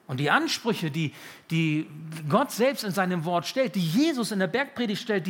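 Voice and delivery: fervent preaching. Acted. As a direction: press the hard demand home. Preacher quoting the Sermon on the Mount.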